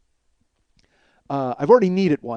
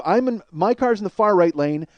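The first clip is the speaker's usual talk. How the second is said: ordinary talk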